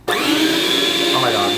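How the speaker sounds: high, slow startled squeal; surprised squeal. Real, not acted. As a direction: gasp it out, startled. Slicer startles her.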